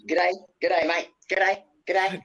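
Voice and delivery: cheery outback bloke nodding at every passer-by; Crocodile Dundee g'day. Retold or imitated. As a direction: Dundee greeting crowds on the street, hammed-up Aussie. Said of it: "And he says".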